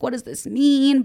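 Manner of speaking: high, slow worried question; anxious. Retold or imitated. Worrying to herself during a talk on boundaries, high and slow. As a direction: ask it high and slow, fretting.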